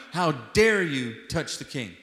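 loud, slow, outraged "How dare you touch the king?"; furious rebuke. Acted. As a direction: thunder David's rebuke at the man who killed Saul. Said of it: "he's enraged".

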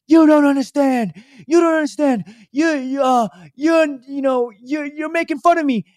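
high, worked-up raspy rant; raspy bagel guy. Retold or imitated. Bagel guy protesting he's mocked, high rasp. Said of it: "he just has a raspy voice".

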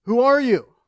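half-earnest shouted question in a story; retold yell. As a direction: call out as the stranded climber, acted. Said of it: "And he yells out".

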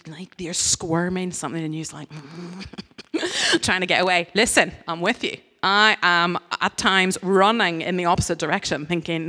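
ordinary talk

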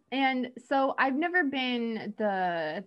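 normal talking voice

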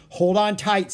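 loud, high, forceful order; urgent call. Acted. Jack pulling Annie on the branch, urgent call. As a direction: shout to hang on.

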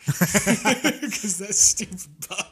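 high, loud laughing through the words. Real, not acted. Hunter telling friend about a nuisance buck, laughing. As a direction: crack up while explaining yourself.

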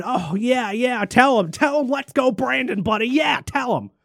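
high mocking cheer; mock an eager God cheering; sarcastic God voice. Sarcastically voicing God cheering him on, high and mocking. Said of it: "God is up there right now going".

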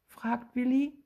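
plain reading voice

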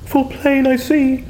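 put-on high, wild voice of a boy gone mad; crazed boy. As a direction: cackle it out like a madman. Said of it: "who now doth crazy go".

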